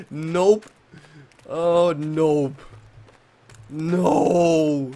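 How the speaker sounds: loud panicked nope; yelling no in a panic; being chased. Real, not acted. Fleeing wolves in panic.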